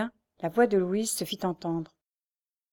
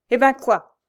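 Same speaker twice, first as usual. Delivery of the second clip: loud, higher snapping cut-off; rude interruption; 5 semitones higher, 10 dB louder than usual. A narrator's reading. Mother cuts off her son, loud and rude. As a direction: cut him off sharply. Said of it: "interrompit la veuve du supplicié".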